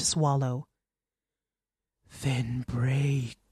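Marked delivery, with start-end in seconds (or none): 2.2-3.4 low, soft, otherworldly voice; the dead husband's shadow answering Natalie, low and hollow; low ghostly shadow. A narrator's reading. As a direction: speak like a cold shadow spirit. The shadow speaks.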